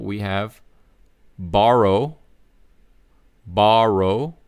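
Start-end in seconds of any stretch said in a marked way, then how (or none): 1.4-4.3 borrow with a curled American R, rising. Shown as a demonstration. Teacher modelling 보로 as borrow, American R. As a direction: read borrow with the tongue not touching. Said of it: "our third example".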